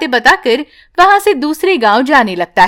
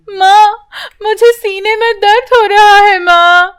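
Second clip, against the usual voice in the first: put-on higher, louder child crying out; a hurting child calling mother; little girl in pain; 8 semitones higher, 3 dB louder than usual. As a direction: daughter telling her mother her chest hurts.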